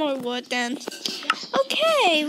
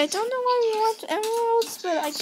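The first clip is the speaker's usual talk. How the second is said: ordinary talk, nothing marked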